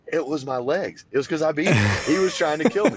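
put-on high, loud, escalating kid's protest; exasperated reenactment, building to a yell; indignant retold protest. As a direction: climb in pitch and volume as you plead your case. Retelling his protest against dad's version.

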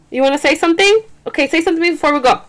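high coaxing voice, the second part fast. Real, not acted. Mom urging her baby to say something, high and coaxing.

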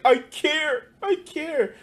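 high, whiny fake sob; mock crying. Mocking Panetta crying over taxes, high whiny fake sob. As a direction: imitate a politician's crocodile tears. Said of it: "who was just crying".

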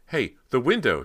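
high, loud sudden discovery; excited cry. A narrator's reading. Cody spots a way out, excited loud cry. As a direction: burst out with it, urgent. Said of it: "she cried".

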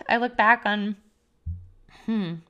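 real emotion, halting with long pauses; close to tears. Real, not acted. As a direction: pause to hold back tears. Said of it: "I was not expecting to cry".

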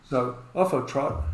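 ordinary talk

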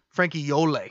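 Frankie Yole with two syllables in the surname, YOH-lay; original pronunciation. Shown as a demonstration. Host gives the gangster's original name, Frankie Yole as YOH-lay. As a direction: end Yole on an accented "lay". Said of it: "it's like I O L E apostrophe".

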